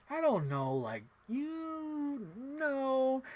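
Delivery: drawn-out high hesitation; doubtful streamer imitation. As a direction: stretch the last words slowly up high. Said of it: "And Becky's like".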